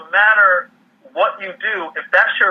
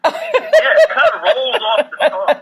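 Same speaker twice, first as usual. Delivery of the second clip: high, slow line bubbling with laughter; laughing; 3 dB louder than usual. Real, not acted. Guest jokes about his handle, laughing. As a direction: chuckle it out, pleased.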